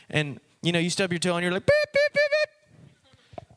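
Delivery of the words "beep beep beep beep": very high imitated bleep tone; censor beeps. Imitating a cusser who stubbed a toe, high censor beeps. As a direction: bleep out a curse in a high squeal. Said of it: "you really didn't say beep".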